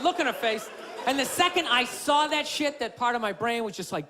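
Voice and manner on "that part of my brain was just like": usual speaking voice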